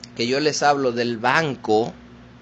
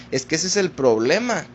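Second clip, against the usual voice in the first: usual speaking voice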